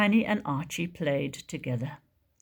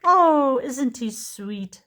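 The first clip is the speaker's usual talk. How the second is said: cooing mum; 6 semitones higher, 5 dB louder, slower than usual. Acted. Mum coos over new baby.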